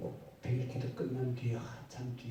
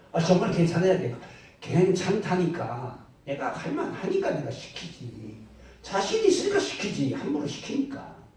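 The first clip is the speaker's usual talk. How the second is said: loud, forceful reassurance, the last line much higher; insistent shout; 5 semitones higher, 10 dB louder than usual. Retold or imitated. Pastor insisting to the worried believer, in a loud forceful voice. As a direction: shout it to convince him, slowing in the middle.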